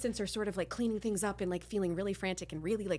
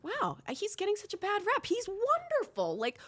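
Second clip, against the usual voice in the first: naive gushing. Retold or imitated. About Harvey, naive high gushing voice. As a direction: gush way up high like a fan. Mocking her naive self.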